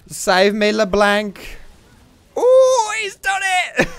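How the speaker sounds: high, very loud yell; excited shouting. Real, not acted. Player calling on LeBlanc to save him, excited shouting. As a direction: shriek it as the escape comes off. Narrow escape in game, shouting.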